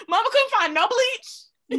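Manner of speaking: high, loud, slow wail; mock despair. Real, not acted. Roasting her Infinity War hair, loud and high. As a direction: moan it like a comic tragedy.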